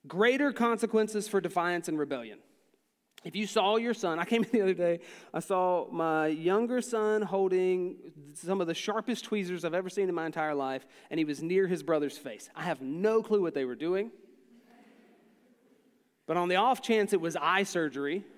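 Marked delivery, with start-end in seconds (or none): none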